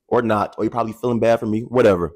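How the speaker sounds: ordinary talk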